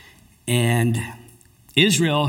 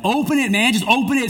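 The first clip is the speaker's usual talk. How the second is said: urging; 9 semitones higher than usual. Retold or imitated. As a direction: push him to open it now. Friends urging him to open box.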